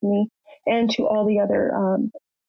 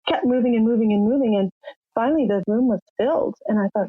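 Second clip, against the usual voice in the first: normal talking voice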